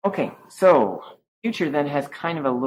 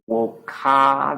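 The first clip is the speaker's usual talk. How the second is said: woka with a long, strong final A; slow, drawn-out woka; dominant sound; 3 semitones higher, 4 dB louder than usual. Shown as a demonstration. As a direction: hold the A at the end of woka. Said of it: "There's a dominant sound".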